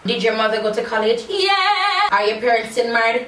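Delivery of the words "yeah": high, drawn-out squeal of agreement; exaggerated answer. Real, not acted. Vlogger answering a viewer question, high held "yeah". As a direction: call it out high and long.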